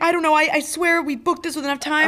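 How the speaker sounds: high, loud, flustered panic; panicking. Retold or imitated. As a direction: blurt it out, rattled and panicking. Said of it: "I, like, started to panic".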